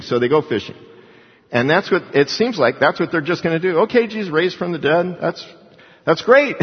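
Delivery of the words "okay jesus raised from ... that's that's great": higher, breezy, unbothered church-goer; mock casual shrug. Preacher mimicking indifferent Christians, mock breezy. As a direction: toss it off like it's no big deal. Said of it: "just like so many Christians".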